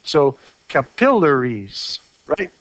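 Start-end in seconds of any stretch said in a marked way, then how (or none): 0.7-2.0 slow, deliberate capillaries stressed on PILL, "kuh-PILL-uh-reez"; stress before double consonant. Shown as a demonstration. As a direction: draw out the second syllable of capillaries. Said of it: "stress goes on the syllable just before".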